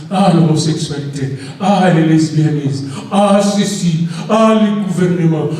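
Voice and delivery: ordinary talk, nothing marked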